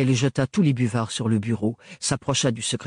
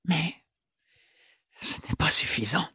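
higher, softer murmur; 6 semitones higher, 5 dB quieter than usual. A narrator's reading. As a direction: let it fall to a soft murmur. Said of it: "murmura-t-elle". (murmuré, plus doux)

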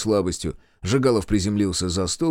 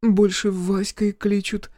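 village bride; 10 semitones higher, slower than usual. Acted. Shy village bride answers.

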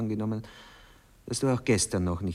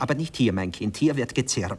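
insistent; 3 semitones higher, 7 dB louder than usual. Acted. Stubborn argument over crickets.

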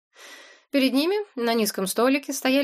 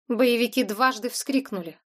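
ordinary delivery

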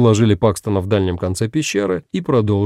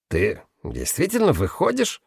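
softer, higher doubting question; distrustful; 4 semitones higher, 5 dB quieter than usual. A narrator's reading. Guerrera checks whether Paxton truly goes, quietly incredulous. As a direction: ask it with disbelief. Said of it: "с недоверием поинтересовался Геррера".

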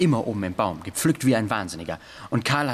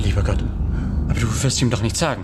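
frightened plea; 6 dB louder, faster than usual. Acted. Boy terrified of being reported.

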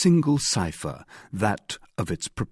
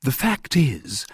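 slow, hesitant opening, slightly raised in pitch; timid; 4 semitones higher, slower than usual. A narrator's reading. D'Artagnan ventures an explanation to the guardsmen, timidly. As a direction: venture it cautiously, drawn out. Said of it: "hazarded D'Artagnan timidly".

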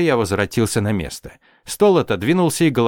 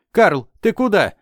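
high startled question; suddenly alarmed, pitched up; 9 semitones higher, 3 dB louder than usual. A narrator's reading. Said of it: "встрепенулась Пончик". (встревоженный высокий вопрос)

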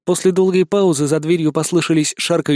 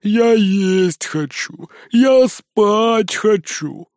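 whining; 5 semitones higher, much slower than usual. A narrator's reading. Zhorik whining, hungry and tired.

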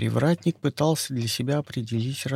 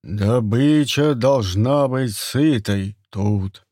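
slow, loud hunter's command; gruff hunter voice; 4 dB louder, much slower than usual. Acted. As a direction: lay down the law slowly. Turgam raises his voice.

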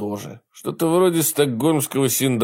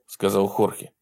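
ordinary delivery, nothing marked

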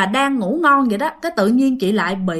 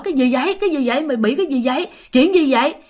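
higher, urgent voices of worried friends; mock-alarmed, pitched up; alarmed questioning; 3 semitones higher, faster than usual. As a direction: fire off the questions, imitating a startled crowd. Acted friends rushing in, asking anxiously.

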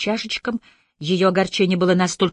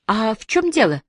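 higher, challenging question; with a note of defiance, pitch raised; defiant; 6 semitones higher, 3 dB louder than usual. A narrator's reading. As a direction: throw it back as a challenge. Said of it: "с вызовом спросила Бобби".

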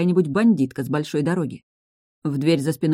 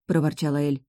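usual speaking voice

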